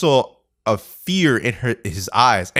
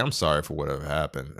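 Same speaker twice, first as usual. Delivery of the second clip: lower, much softer, hurried apology; retold sheepish apology; 9 semitones lower, 9 dB quieter, faster than usual. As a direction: act out a subdued apology to a friend. Retold apology after yelling at him.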